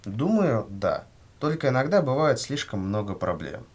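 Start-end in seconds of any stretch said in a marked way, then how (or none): none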